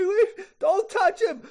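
high-pitched tearful begging; Karen crying. Acted. Karen begging the man to put the knife down, crying. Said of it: "she is fucking crying now".

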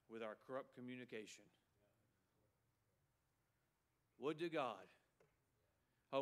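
low, hushed pulpit plea; solemn lament. Real, not acted. Preacher grieving church's bitterness and grudges, solemn quiet lament. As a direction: let it sink quietly, heavy with regret.

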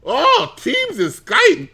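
very high, slow falsetto marveling; mock ecstatic delight. Gushing over Teams and Skype in admin center, mock ecstasy. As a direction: coo it way up high, stretching each word.

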